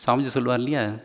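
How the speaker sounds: ordinary talk, nothing marked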